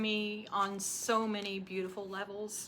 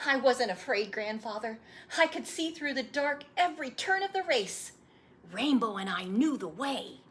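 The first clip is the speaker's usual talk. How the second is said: put-on lightly higher eager boy; an eager young boy begging for the story; young boy voice, a little higher; boy's voice; 4 semitones higher, 6 dB louder than usual. Boy answers.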